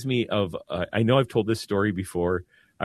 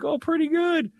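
much higher-pitched imitated answer; imitated casual reply. Homeless man answering the priest's greeting, imitated casual reply. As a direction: lightly voice the other man, pitched up.

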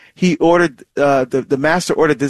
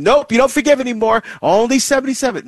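quick, much higher mock "nope" voice; hammed-up refusal, fast and raised; mock stingy cutoff; 9 semitones higher, faster than usual. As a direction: play a petty rule-keeper, brisk and high. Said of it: "Jesus is not saying actually seventy-seven".

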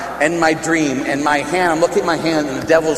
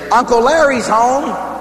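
light sister call; 8 semitones higher, 3 dB louder than usual. Retold or imitated. Sister announcing happily.

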